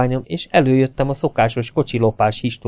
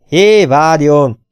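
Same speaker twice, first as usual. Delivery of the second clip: slow, louder call to stop her; 2 semitones higher, 4 dB louder, much slower than usual. A narrator's reading. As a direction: stretch out a loud call after someone leaving. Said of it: "kiáltotta Sam".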